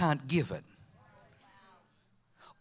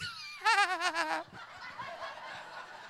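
high laugh; 12 semitones higher, 5 dB quieter than usual. Real, not acted. Laughing at own boldness.

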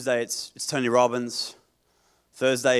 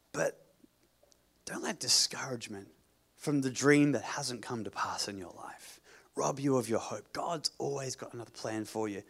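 whispering; 2 semitones lower, 9 dB quieter than usual. Real, not acted. Quiet pastoral moment.

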